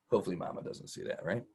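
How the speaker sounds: hushed, fast joking aside; whispered aside. Real, not acted. Dad joking to son about mama, hushed aside. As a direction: whisper it so mama won't hear.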